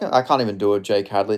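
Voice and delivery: ordinary delivery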